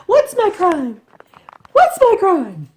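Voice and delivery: loud, high-pitched mock scream voicing an outraged kitten; screaming kitten impression. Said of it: "the kittens cry and scream and go".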